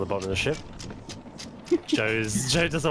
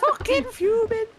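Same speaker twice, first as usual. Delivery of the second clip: mock fuming. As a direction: ham up the fury while laughing. Repeats joke about fury.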